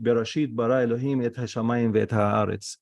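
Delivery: quick run-through with no gaps between words; rushed reading. Shown as a demonstration. Teacher showing students how not to read, rushed. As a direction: rattle the Hebrew verse off fast. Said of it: "don't read quick".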